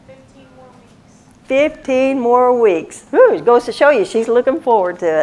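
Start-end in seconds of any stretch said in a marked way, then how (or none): 0.1-1.2 barely voiced whisper from the pews; a faint whispered reply. Real, not acted. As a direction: whisper it almost silently. Voice from the room answers.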